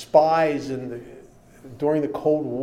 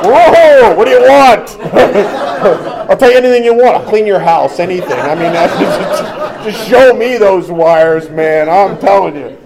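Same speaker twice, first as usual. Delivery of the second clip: hammed-up terrified begging, much louder and higher, laughing; mock panic; 5 semitones higher, 15 dB louder than usual. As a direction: beg like a coward who'd spill everything at the sight of wires. Imagines himself shown the wires.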